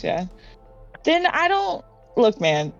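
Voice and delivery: usual speaking voice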